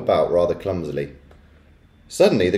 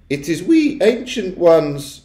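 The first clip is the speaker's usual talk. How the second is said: slow, high, meek ancient witch; 9 semitones higher, slower than usual. A narrator's reading. Ancient witch outside the door, meekly. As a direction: announce yourself timidly in a high, drawn-out voice. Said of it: "said a meek voice".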